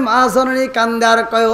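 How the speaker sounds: ordinary talk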